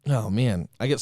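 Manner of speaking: usual speaking voice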